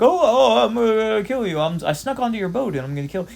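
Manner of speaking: high, loud mock-menacing villain voice; hammed-up villain threat. Filler villain threatening the crew, hammed-up high and loud.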